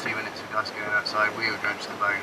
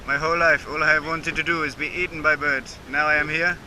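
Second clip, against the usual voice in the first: flying fish voice; 5 semitones higher than usual. Pretend. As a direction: play a cheerful doomed fish. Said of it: "I am Flying Fish Frank".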